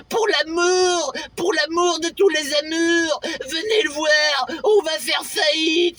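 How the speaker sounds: hammed-up desperate trailer plea. Begging audience to see film.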